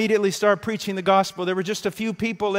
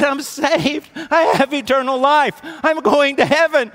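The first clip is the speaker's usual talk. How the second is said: excited, louder and higher retelling of his joy; exclaiming in a raised voice; joyful exclaiming; 9 semitones higher, 10 dB louder than usual. Retold or imitated. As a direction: burst out with the joy of being saved.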